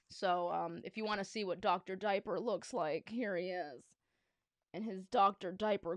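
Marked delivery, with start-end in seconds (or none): none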